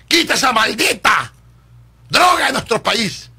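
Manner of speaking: furious plea against drugs; shouted demand. Real, not acted. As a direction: hammer each word at the president.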